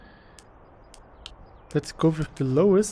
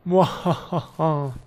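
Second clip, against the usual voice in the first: slow mock villain cackle; slow hammed-up evil mwahaha; evil laugh; 5 dB louder, slower than usual. Gloating after being correct.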